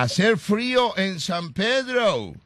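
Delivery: put-on high, slow gringo-accented Spanish; mock English. Host asking Eva about the cold, mock English accent. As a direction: drawl Spanish words as fake American English. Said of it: "si hace frío en inglés".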